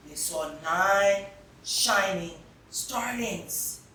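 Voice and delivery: ordinary delivery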